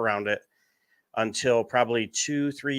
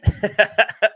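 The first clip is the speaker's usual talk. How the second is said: big burst of laughter; loud laugh; 10 semitones higher, 8 dB louder than usual. Real, not acted. Laughs at partner's joke.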